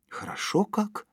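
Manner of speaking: high, spontaneous exclamation; involuntary exclamation. A narrator's reading. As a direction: let it escape on a high rise. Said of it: "невольно воскликнул Женя".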